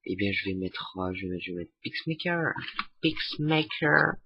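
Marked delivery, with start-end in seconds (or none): 1.8-2.5 hammed-up English accent on Pixmaker; mock English accent. Tutorial host typing sample text, mock English accent. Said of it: "Super accent anglais". 3.0-4.2 higher, drawn-out Pixmaker in a hammed-up English accent; mock English accent. Tutorial host typing sample text, exaggerated English accent. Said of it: "Super accent anglais".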